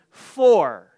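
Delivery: "for" punched out loud and long; a loud, isolated "for"; emphatic FOR. Real, not acted. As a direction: hit "for" hard as the key word. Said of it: "that's very important".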